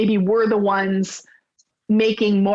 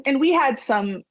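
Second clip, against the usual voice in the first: ordinary talk, nothing marked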